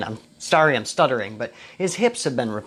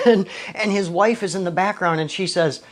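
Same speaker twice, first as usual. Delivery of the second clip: ordinary talk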